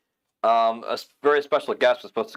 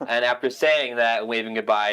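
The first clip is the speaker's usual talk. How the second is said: usual speaking voice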